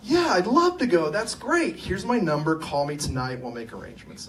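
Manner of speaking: put-on a girl happily accepting a dance invite; delighted yes. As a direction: gush that you'd love to go. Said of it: "and she goes".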